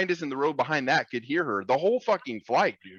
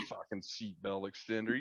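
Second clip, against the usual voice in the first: muttered retort; 3 semitones lower, 14 dB quieter than usual. Retold or imitated. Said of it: "I'm like".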